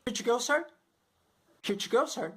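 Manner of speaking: "Here to go, sir" run into one quick chunk; words run together. Shown as a demonstration. McDonald's cashier asks a customer, words run together. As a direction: drop the "for" and blend "Here to go, sir". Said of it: "você só vai ouvir".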